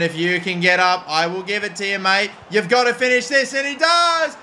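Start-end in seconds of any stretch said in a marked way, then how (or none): 2.5-4.4 climbing up to a high shout; excited goal call. Real, not acted. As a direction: call the winner going in. Winning goal called live.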